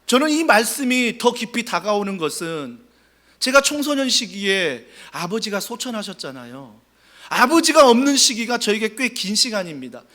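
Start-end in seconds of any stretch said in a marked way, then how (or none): none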